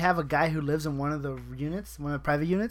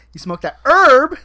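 herb repeated much higher and louder, slow; loud mock coworker; 9 semitones higher, 14 dB louder, slower than usual. Coworker pressing about weed, repeating it louder and higher. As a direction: hit herb high and loud the second time. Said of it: "all my coworkers will be like".

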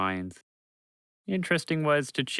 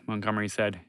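usual speaking voice

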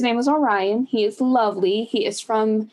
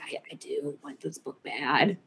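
mock-hushed longing; 8 semitones lower, 10 dB quieter than usual. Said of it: "I'm like".

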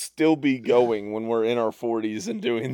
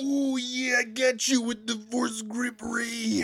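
high, gruff wrestler-promo growl as a movie villain; Macho Man Kylo Ren; 8 semitones higher, 7 dB quieter, slower than usual. Pretend. Friend voices Kylo taunting Rey, wrestler promo voice. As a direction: ham it up like a pro wrestler cutting a promo. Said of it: "Is that Macho Man Kylo Ren?".

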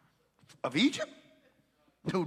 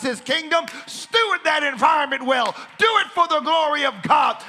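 rising preaching voice, louder at end; building sermon; 9 semitones higher, 10 dB louder than usual. Acted. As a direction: build to a proclamation.

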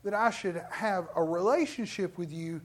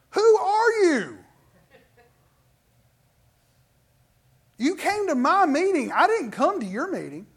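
indignant retort; 8 semitones higher, 7 dB louder than usual. Retold or imitated. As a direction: put the heckler in his place. Preacher retells rebuffing a critic.

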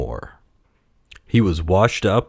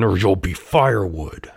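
menacing snarl at usual pitch. A narrator's reading. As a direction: snarl it as a threat. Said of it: "snarled the man".

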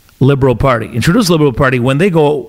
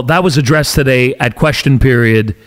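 normal talking voice

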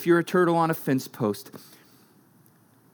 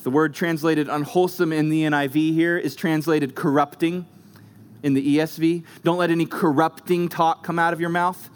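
normal talking voice

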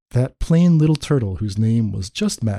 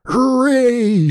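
high, drawn-out cheer; exultant storybook shout; triumphant shout; 10 semitones higher than usual. A narrator's reading. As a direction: cheer like a delighted king. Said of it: "shouted Yertle".

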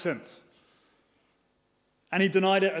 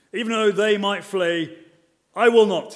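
boastful vow; 3 semitones higher, 7 dB louder than usual. Retold or imitated. Peter vows to Jesus.

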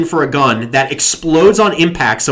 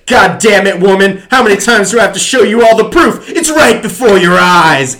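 loud, higher cliche hero yell; mock exasperated shout; 6 semitones higher, 8 dB louder than usual. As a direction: hammer it like a cheesy action lead. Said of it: "And he's like".

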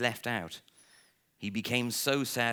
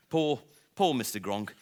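usual speaking voice

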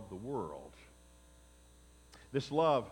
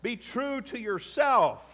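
higher and louder, mock self-help preaching; mocking the world's mantra; 7 semitones higher, 7 dB louder than usual. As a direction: parrot the selfish slogan with scorn. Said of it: "the mantra is".

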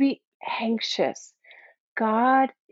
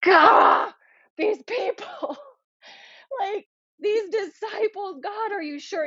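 much higher, half-crying plea to God; exasperated lament; 10 semitones higher, slower than usual. Retold or imitated. Jesus complaining to God about his disciples, high exasperated plea. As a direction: voice Jesus pouring out frustration in prayer. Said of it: "where he was like".

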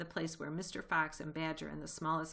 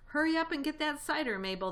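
high, loud call through the house; 10 semitones higher, 6 dB louder, faster than usual. A narrator's reading. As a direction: call to the servant from afar. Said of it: "woman's voice calling out".

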